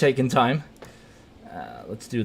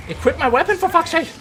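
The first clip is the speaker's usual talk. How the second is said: high-pitched exasperated yell; exasperated outburst; 4 dB louder than usual. Real, not acted. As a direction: yell at the game in disbelief. Weapon glitches during play.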